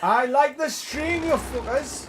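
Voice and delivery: slow, loud, high holler; drawn-out shout to the stream audience; calling out. Real, not acted. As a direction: drag out a shouted plug to the viewers. Echoing the call to viewers.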